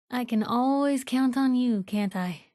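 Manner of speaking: normal talking voice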